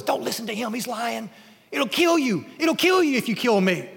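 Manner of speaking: a parasite begging not to be cut off; frantic shoulder creature. Acted. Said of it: "the thing on the man's shoulder says".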